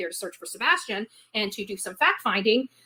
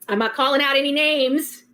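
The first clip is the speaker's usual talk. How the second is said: ordinary talk, nothing marked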